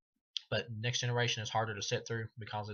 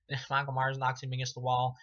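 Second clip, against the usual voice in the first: ordinary delivery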